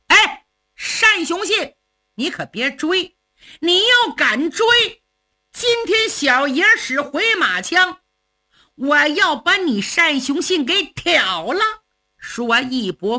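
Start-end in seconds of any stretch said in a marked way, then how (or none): 0.1-11.6 taunting call. Acted. Luo Cheng rides off, calling a mocking warning to Shan Xiongxin. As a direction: warn him off with a cocky shout.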